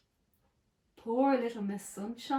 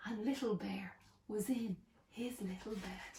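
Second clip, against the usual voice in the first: whisper; 13 dB quieter than usual. A narrator's reading. Bedtime, whispered hush.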